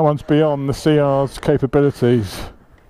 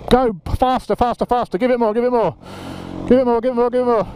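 urging on; 6 semitones higher, faster than usual. Real, not acted. Coaching rider up the hill.

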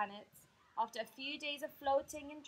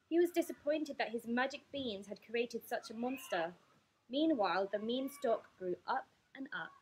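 ordinary delivery

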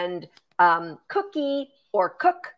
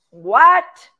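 loud, high, astonished outburst; surprised; 4 semitones higher, 12 dB louder than usual. Real, not acted. Host seeing the donation running total, loudly surprised. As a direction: burst out in real surprise.

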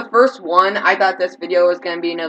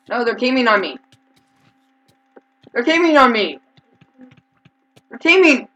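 rising higher and louder outrage; outraged complaint; 5 semitones higher, 7 dB louder than usual. Real, not acted. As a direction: build the protest to a yell. Outraged at being ganged up.

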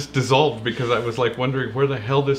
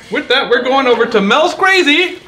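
high, loud announcer lift; hyped segment intro; host announcement; 10 semitones higher, 5 dB louder than usual. Acted. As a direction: pitch the segment title like a show host.

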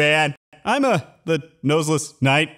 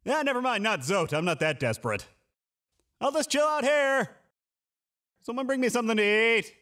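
ordinary delivery

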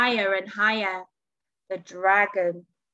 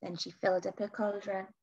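usual speaking voice

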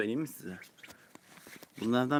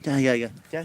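calling the ducklings louder, over and over; 3 semitones higher, 6 dB louder than usual. Real, not acted. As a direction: call them to come, a little raised.

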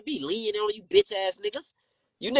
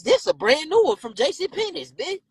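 insistent repeat; 7 semitones higher than usual. Real, not acted. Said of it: "Bitch, I, bitch".

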